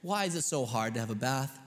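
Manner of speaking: normal talking voice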